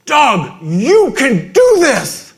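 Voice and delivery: very loud, high, drawn-out rallying shout; coach's in-your-face yell. Retold or imitated. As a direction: imitate a coach yelling encouragement. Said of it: "he got in my face".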